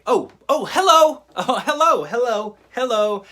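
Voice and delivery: loud, high, hammed-up happy hellos; cheerful mock greeting. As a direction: greet the kids like you misheard them, beaming. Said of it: "I just start responding".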